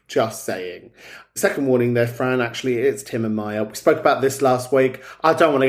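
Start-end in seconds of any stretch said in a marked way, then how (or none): 0.1-0.9 low, soft deadpan just saying; a muttered low "Just saying". Acted. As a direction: drop it low and dry. Teasing repeat, low and soft.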